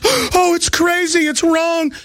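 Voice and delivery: high, gasping pearl-clutcher; mock outrage. Host mimicking reaction to his pointed finger, high mock outrage. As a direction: imitate critics in a horrified fluster. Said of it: "and it was".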